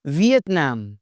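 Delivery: "वियतनाम" ending in a drawn-out ae, nam; correct pronunciation. Shown as a demonstration. Teacher modelling the country name, "वियतनाम" ending naem. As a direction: say "वियतनाम" as viet-NAEM, last syllable stressed. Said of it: "वियतनाम नहीं".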